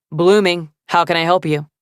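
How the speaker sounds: lightly voiced man answering the shop phone; male voice on phone. A narrator's reading.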